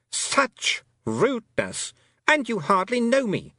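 high, slow, brittle offence; affronted fairy queen. A narrator's reading. A fairy queen affronted by a rude girl. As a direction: act scandalised, icy sweet.

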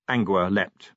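ordinary delivery, nothing marked

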